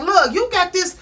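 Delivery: high-pitched retold complaint; fed-up confrontation. Woman confronting her man over his mess, fed up.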